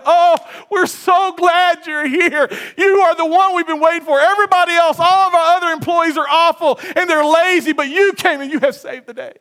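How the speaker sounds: mock boss showering praise; gushing boss. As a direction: gush over the new hire like a flattering boss. Flattering boss quoted in sermon.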